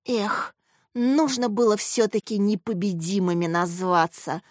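ordinary delivery, nothing marked

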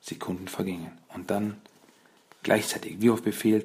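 ordinary delivery, nothing marked

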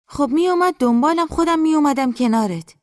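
high, loud character voice, falling at the end; insistent mother. Acted. Mother tells daughter she'd have come, high and insistent. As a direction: protest it high, then let it drop.

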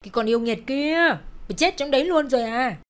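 irritated mother yelling into the shop; impatient shriek. Acted. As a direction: screech for her to hurry out. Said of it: "sốt ruột rít lên".

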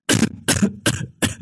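quiet rasping cough fit; long cough. A narrator's reading. Sick mother coughing in the night. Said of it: "tiếng ho dài của bà".

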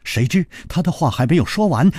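usual speaking voice

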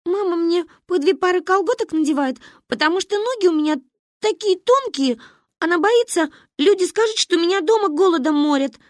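put-on high, loud little girl whine; complaining child. A narrator's reading. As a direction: pour out a childish grievance. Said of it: "пожаловалась я".

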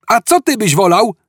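high-pitched shouted retort; shouting back. A narrator's reading. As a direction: holler the question back. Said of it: "odkrzyknął Ford". (odkrzyknięte pytanie)

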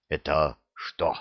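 low, slow, stunned question; taken aback. A narrator's reading. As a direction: ask slowly, suddenly deflated. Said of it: "Спал с лица старейшина". (ошеломлённо, растерянно)

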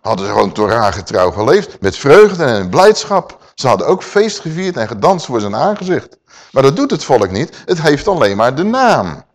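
ordinary talk, nothing marked

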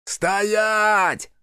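high, long-held shout; shouted command. Acted. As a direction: shout a halt across a distance, stretching the word. Pursuing marauders shout at fugitives.

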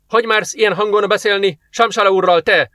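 high, outraged protest; indignant outburst. A narrator's reading. Guard leader defends his master's honour, indignant. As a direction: flare up in your master's defence. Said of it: "hördült fel parancsolója védelmében".

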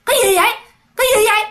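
high-pitched angry ghost outburst; acted sudden hostility from a possessed woman; fierce retort. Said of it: "trở nên hung dữ".

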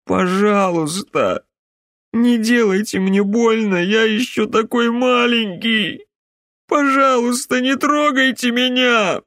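put-on high, pleading little girl; little girl's voice. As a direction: beg in a tiny child's voice. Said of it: "захныкал он, не узнавая собственного голоса".

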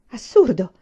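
loud burst of outrage; indignant exclamation. A narrator's reading. Teacher hears of the unexplained arrest, exclaiming indignantly. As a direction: exclaim it as an affront. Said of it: "esclamò Miss Maitland".

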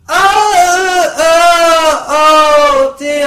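loud, high hammed-up wailing; grieving old woman. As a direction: wail like an old woman in mock grief. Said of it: "an old woman expressing her grief".

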